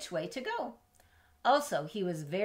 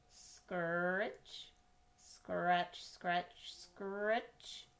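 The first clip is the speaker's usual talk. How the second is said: hoe scratching noise; 5 dB quieter, much slower than usual. Acted.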